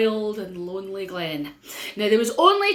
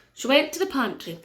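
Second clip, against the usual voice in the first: ordinary delivery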